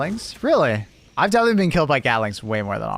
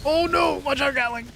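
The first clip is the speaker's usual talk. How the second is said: high panicked alarm; being chased; 13 semitones higher than usual. Real, not acted. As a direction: yelp a sudden warning.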